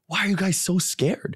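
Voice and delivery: high, mock-baffled question; incredulous. Teen to his timid friends, high incredulous teasing. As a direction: tease them for being too scared.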